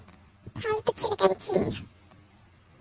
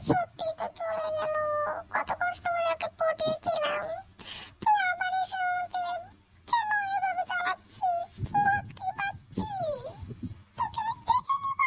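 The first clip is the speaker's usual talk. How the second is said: high, whimpering comic sob; mock crying; 8 semitones higher, 4 dB quieter than usual. Girlfriend crying after her rich boyfriend leaves. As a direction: wail like a spoilt child losing a toy. Boyfriend walked off, she laments.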